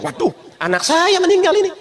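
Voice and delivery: high startled gasp of grief; shocked cry. Retold or imitated. Sheikh realizing his child died, high and shocked. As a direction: blurt it out in shock. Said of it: "Sadar Syekh".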